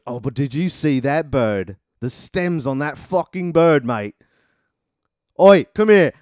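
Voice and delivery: loud, high Cockney yelling; slow leering Cockney, then shout Oi; Cockney catcalling. Acted. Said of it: "he starts yelling after her".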